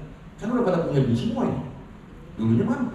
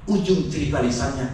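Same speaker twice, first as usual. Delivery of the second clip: ordinary talk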